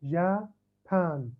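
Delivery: "جابان" slowly, as two separate syllables; split into two parts. Shown as a demonstration. Teacher demonstrating syllables to the class, slowly split in two. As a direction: say "جابان" as جا, pause, بان. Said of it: "كأنه قرأتها على قسمين".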